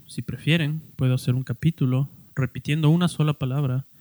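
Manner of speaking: normal talking voice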